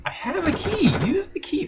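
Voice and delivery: very high, loud shriek; frustrated shriek. Real, not acted. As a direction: scream the demand at the game. Frustrated with game again.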